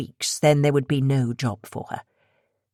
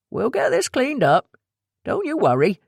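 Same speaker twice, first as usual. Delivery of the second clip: reassuring man; 9 semitones higher than usual. Acted. Lucky reassuring Abby.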